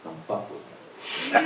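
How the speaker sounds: low, slow and hushed, suddenly dead serious; sober menace. Retold or imitated. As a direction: drop to a quiet threat, slowly. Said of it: "I went from sloppy drunk to".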